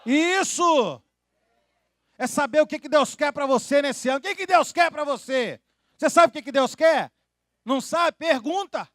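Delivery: loud, high preacher's call; fiery preaching. Acted. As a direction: drive the question home to the church. Preaching at full force.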